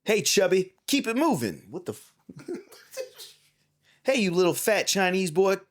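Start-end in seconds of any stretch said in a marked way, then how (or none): 0.0-1.7 high, loud mock taunt; taunting announcer. 4.0-5.6 high, loud mock taunt; bully the player from the screen; taunting announcer.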